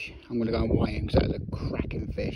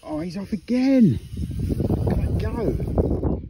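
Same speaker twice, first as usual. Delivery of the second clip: excited; 9 semitones higher, 9 dB louder, slower than usual. Real, not acted. Fish runs off with the line.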